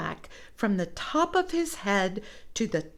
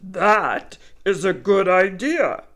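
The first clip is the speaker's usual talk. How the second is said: bear voice; 9 dB louder than usual. Acted. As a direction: answer like a gullible big bear. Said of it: "Bear said".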